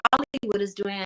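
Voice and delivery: ordinary talk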